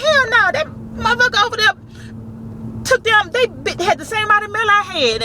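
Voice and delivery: high-pitched outraged rant; indignant protest. Retold or imitated. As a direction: argue back at the unfair boss. Arguing back at supervisor.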